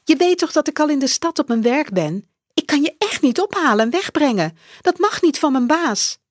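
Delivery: hurried motherly excuse; apologetic mother. Acted. Mother on the phone from work, high and hurried.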